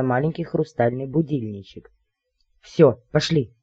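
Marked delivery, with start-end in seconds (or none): none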